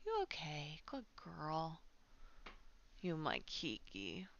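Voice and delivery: soft, slow whispered comfort. Real, not acted. Streamer checking on her cat, soft slow whisper. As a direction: hush gently to the cat.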